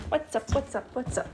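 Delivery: high sing-song bird talk; teaching a parrot. Real, not acted. Talking to the bird.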